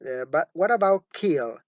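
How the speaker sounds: ordinary delivery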